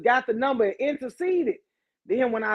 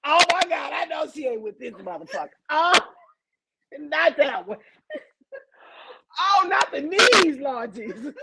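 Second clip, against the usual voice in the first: high, hammed-up panicked wailing; panicked pretend wail; 6 semitones higher than usual. Imagining her sneak attack, hammed-up panicked wailing. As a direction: wail like a scared man begging. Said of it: "and I be like".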